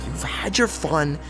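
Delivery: usual speaking voice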